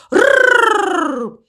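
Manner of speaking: long high rolled-r roar; high, drawn-out pretend growl; raven's growl. A narrator's reading. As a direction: let out a long trilled tiger roar. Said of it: "Ворон зарычал".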